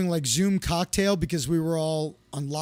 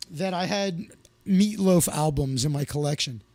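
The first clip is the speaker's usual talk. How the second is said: ordinary delivery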